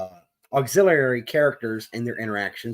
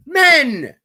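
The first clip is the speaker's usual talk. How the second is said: high, loud, emphatic shout; exasperated shout; 12 semitones higher, 8 dB louder than usual. Real, not acted. Ranting host shouts the answer at his listeners. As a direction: slam the answer home in exasperation.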